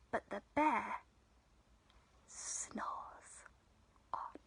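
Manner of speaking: very soft, fading to a near whisper; hushed narration. A narrator's reading. Storyteller says the bear sleeps through chatter, hushed. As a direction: tiptoe round the sleeping bear.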